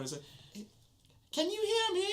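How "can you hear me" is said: high, muffled, a bit louder; muffled call. Acted. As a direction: call out from inside a chest. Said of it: "you hear the voice a little bit louder".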